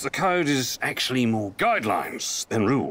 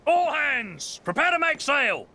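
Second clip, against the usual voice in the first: captain calling all hands to sail; shouted orders; 13 semitones higher than usual. Acted. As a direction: bellow it across the deck.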